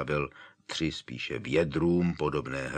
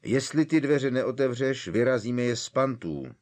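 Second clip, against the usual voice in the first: higher, louder threat; threatening; 7 semitones higher, 5 dB louder than usual. A narrator's reading. Harry threatens Hagrid through the locked door, loud. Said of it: "vyhrožoval Harry".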